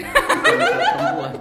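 high laughing punchline. Real, not acted. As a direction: squeal it through a laugh. Joke after ex remark.

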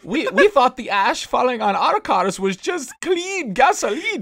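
high, far-off mock wail; high wailing neighbor. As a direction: wail it in fake innocence.

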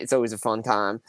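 ordinary talk

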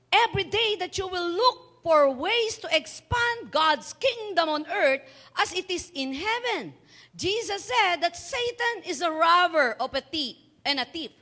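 loud, high, slow preaching; preaching at full force. Acted. Pastor to congregation, loud slow preaching. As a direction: preach it out slowly to the church. Opening English sermon lines preached hard.

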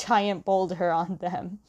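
ordinary talk, nothing marked

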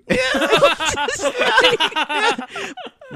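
long high-pitched burst of laughter; big laugh. Real, not acted. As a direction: lose it laughing at the story. Cracking up at Pikachu fight story.

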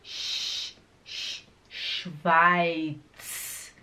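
SCHWEIZ built up from a voiceless, held SH; isolated SCH. Shown as a demonstration. Teacher building the word for learners, slow hushing SCH. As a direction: hiss "sh" alone, then draw out "Schweiz". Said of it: "assim, ó".

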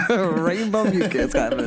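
laughing through the words. Real, not acted. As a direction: laugh it out, slow and loud.

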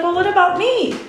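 put-on childlike protest. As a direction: play the small child pushing back. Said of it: "I would look and be like".

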